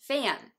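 "フェアン" joined as "feh-an"; native pronunciation. Shown as a demonstration. Teacher repeating the example, "フェアン" as English fan. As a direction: glide through e into a in "フェアン". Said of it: "ネイティブはエフエーエヌをフェアンと".

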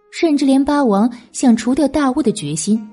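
ordinary delivery, nothing marked

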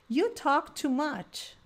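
slow, clear drill sentence; slow drill model. Shown as a demonstration. Teacher leading a repeat-after-me drill, slow. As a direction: model it slowly so they can repeat. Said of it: "Vamos repetir essas frases".

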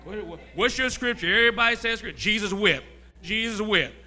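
put-on loud, chanted kid's verse; mock dinner-table recital. Children reciting scripture at the dinner table, chanted.

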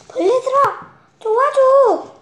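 high, loud plea for help; excited call. Acted. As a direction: call out the title brightly. Title read with excitement.

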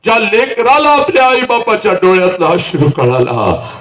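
high, ringing declamation; impassioned oration. Acted. Speaker on children who see parents' tears, ringing high cry. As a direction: raise it high and ring it out over the crowd.

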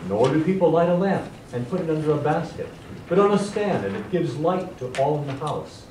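loud, ringing scripture reading; a big booming voice proclaiming the verse. Shown as a demonstration. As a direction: read it out to fill the room. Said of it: "read this verse for us out loud with your nice booming voice".

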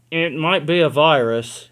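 confident, official pronouncement; authoritative doctor. Acted. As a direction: announce it with authority. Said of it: "he announced with authority".

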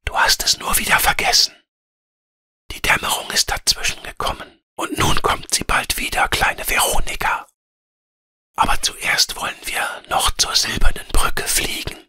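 whispered spirit voice from above; flüsternder Luftgeist. A narrator's reading. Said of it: "sagte der Luftgeist von oben". (geflüstert, wie ein Luftgeist von oben)

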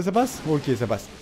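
ordinary talk

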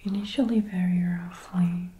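usual speaking voice

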